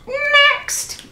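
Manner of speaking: very high squawked "Next"; high parrot screech, ordering. A narrator's reading. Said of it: "blared the parrot".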